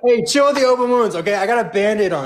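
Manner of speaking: high, quick indignant protest; defensive. Real, not acted. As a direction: push back fast, a bit rattled.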